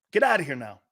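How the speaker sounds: ordinary talk, nothing marked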